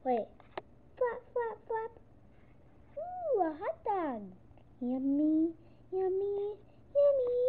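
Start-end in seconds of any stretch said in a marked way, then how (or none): 3.0-4.2 high, slow ooh of wonder; slow, high and delighted; delighted discovery. Acted. Pigeon sees hot dog. 4.8-7.4 each yummy higher, last one loud; rising greed for the food; climbing from plain to high and loud; building yummy. Acted. Pigeon craving hot dog.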